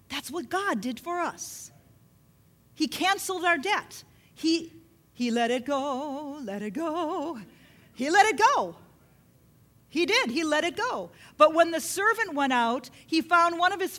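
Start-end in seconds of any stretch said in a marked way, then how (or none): none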